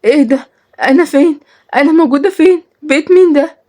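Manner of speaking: put-on high, alarmed young woman; acted fear, pitched well up; frightened. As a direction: wake up scared and disoriented. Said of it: "وحست بالخوف".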